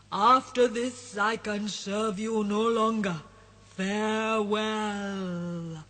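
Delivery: slow, heavy genie farewell; solemn genie. Acted. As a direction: draw out the farewell like a departing spirit.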